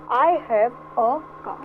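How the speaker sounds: each word spaced out slowly; slow teaching read. Shown as a demonstration. Teacher reading the example slowly to students. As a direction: dictate it slowly for the class. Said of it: "मैंने क्या बोला?".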